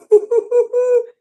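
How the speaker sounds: sudden loud burst of high laughter; a loud, high burst of laughter at the cards. Real, not acted.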